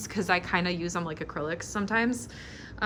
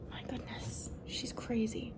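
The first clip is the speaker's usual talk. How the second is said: softer whispered exasperation; whispered aside; 3 semitones higher, 15 dB quieter than usual. Real, not acted. Whispering about the fussing dog so she won't hear. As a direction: hush it under your breath.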